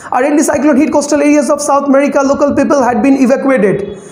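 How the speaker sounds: rushed, run-on news reading; reading without scanning. Shown as a demonstration. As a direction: race through the script without pausing for breath. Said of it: "without scanning, so how would I read?".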